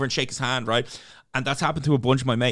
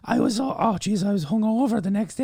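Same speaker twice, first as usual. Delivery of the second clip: high, lilting Irish granny; old Irish woman recalling a hangover; Irish old lady voice; 8 semitones higher than usual. Retold or imitated. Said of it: "that's my Irish old lady voice".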